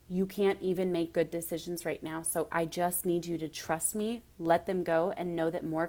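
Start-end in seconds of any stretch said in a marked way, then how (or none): none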